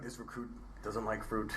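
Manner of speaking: low, quiet recruit recital; a hint of a recruit reporting; barked sir yes sir. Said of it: "I had to be like".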